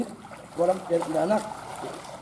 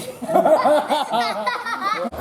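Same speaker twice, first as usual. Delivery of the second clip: giggling laugh; 8 semitones higher than usual. Real, not acted. Kids giggling at the pool.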